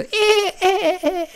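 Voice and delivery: very high, squeaky mocking chitter; giggling dolphin. Dolphins laughing at you, high creature giggle. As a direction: cackle like a sneering dolphin. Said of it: "always giggling and laughing at you".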